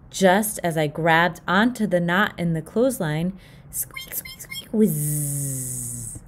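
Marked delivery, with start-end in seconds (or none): 3.7-4.4 high whispered squeaking. A narrator's reading. Clothesline squeaking.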